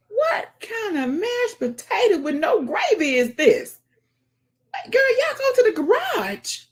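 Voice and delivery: high, loud, outraged exclaiming; shocked outcry. Real, not acted. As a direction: cry it out in disbelief. Shocked reaction while watching stream.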